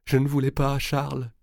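voix qui se brise en larmes, plus haute; éclatant en sanglots. Acted. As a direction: supplier en fondant en larmes. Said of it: "en éclatant en sanglots". (au bord des sanglots)

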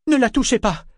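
high, loud, panicked order; alarmed cry. A narrator's reading. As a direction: cry out to protect someone. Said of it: "s'écria Kefria en se précipitant". (cri d'alarme aigu)

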